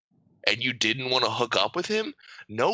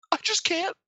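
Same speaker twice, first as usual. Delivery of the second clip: mock whiny. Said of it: "He goes".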